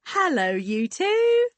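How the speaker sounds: big, high, drawn-out hail; booming greeting. Acted. Hearty woman greeting two people in the garden, booming. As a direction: boom it out, stretching the last word. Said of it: "she boomed".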